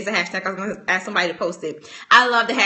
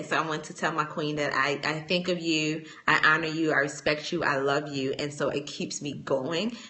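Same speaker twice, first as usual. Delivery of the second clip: warm; 5 semitones lower, 8 dB quieter than usual. Real, not acted. Sincere love to her queens.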